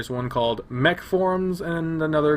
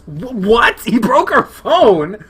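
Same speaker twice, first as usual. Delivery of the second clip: higher and louder, stunned; shocked disbelief; 9 semitones higher, 8 dB louder, slower than usual. Real, not acted. Player learning Leo broke her phone, loud shocked disbelief. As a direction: gasp out the question in disbelief.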